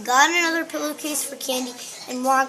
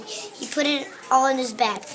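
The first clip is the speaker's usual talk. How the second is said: ordinary delivery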